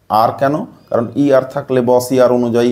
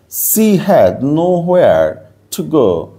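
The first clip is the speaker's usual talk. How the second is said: very slow, raised English reading voice; slow reading aloud; 5 semitones higher, much slower than usual. Shown as a demonstration. Teacher reading the lesson sentence to students, slow and clear. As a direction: read it word by word for the class. Reads the English text slowly.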